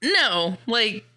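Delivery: real indignation as she retells the date; incredulous. Real, not acted. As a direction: pitch shoots up in outrage. Indignant reaction to the story.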